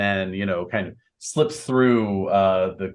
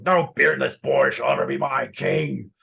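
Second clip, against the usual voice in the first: higher-pitched, defiant mock shout; Lot shouting back; 5 semitones higher than usual. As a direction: retort as a rebel lord refusing the claim. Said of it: "And Lot shouts back".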